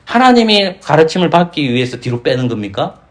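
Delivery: usual speaking voice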